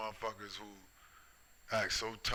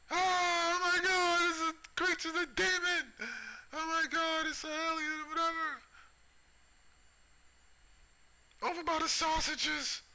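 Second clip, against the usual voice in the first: shrieking falsetto panic, much higher and louder; mock panic; 6 dB louder than usual. Shoppers spotting an alien in Walmart, mock falsetto panic. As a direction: squeal like a terrified shopper spotting a monster. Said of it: "people gonna be running out the store freaking out".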